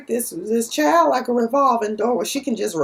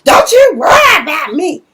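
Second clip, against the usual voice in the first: high, loud snapping comeback; defiant retort; 7 semitones higher, 9 dB louder than usual. Retold or imitated. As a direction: fire it back at your sister, loud and high. Nova snaps back in quarrel.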